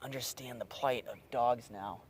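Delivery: breathy whisper to himself; whispered complaint. Real, not acted. Fishing alone at night, whispers about fleas, whispered. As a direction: whisper it, miserable and itchy.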